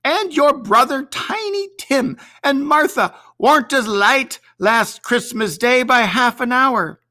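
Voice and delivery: a bustling mother scolding the late ones; Mrs Cratchit. A narrator's reading. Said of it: "said Missus Cratchit".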